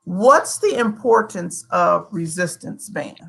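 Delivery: ordinary talk, nothing marked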